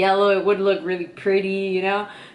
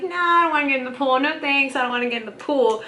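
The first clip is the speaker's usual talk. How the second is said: rapid, higher-pitched brush-off; acted-out refusal; 6 semitones higher, faster than usual. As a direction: re-enact a quick, high refusal. Acting out her refusal.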